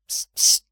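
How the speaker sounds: sharp whispered "psst"; voiceless hushing noise; urgent shushing. Acted. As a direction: shush someone mid-sentence. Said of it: "integetett Siegfried az öreg orra előtt".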